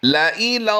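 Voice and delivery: ला इलल्लाही chanted high and melodic; Quranic recitation. Shown as a demonstration. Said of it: "आइए समझते हैं".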